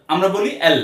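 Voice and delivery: ordinary delivery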